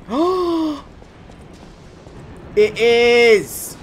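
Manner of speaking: high, loud joyful laughing outburst; elated. Real, not acted. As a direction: burst out in delighted relief. Found the shortcut, elated.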